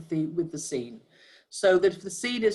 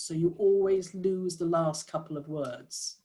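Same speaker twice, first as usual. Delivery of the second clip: ordinary talk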